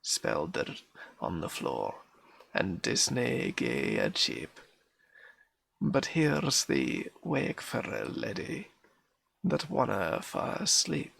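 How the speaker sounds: nursery rhyme recited in broad Scots; Scots accent. Acted. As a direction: put on a Scottish accent, calling out the lively lines. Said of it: "this is gonna be an accent".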